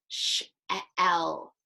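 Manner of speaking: "Sh-, e, l" spelled out sound by sound with gaps; sounding out letters. Shown as a demonstration. Teacher sounds out "shell" piece by piece for the class. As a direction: break "shell" into separate sounds, one at a time. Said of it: "Can you blend S H together?".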